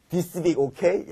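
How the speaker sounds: loud, drawn-out mock tourist English; tacky broken English. Teacher mimics a student abroad at a photo shop, tacky broken English. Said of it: "야, 이거 진짜 저질이야".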